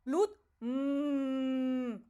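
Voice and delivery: slow, held hum after प्लूत; extended hum like bhramari; prolonged hum. Shown as a demonstration. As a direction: draw the hum out long. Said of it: "time ज़्यादा लग रहा है ना".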